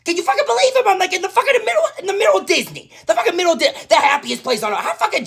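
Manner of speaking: outraged squeal. Real, not acted. Daughter ranting to mom about proposal, high outraged squeal. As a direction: shriek in amused outrage.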